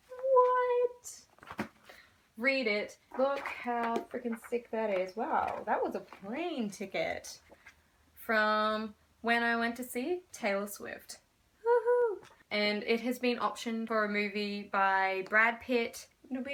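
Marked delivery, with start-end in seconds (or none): 0.1-1.7 high, loud drawn-out "what"; mock amazement. Showing off book with mock amazement. 11.6-12.3 high, loud whoop; excited cheer. Real, not acted. Cheering memory of [name] concert.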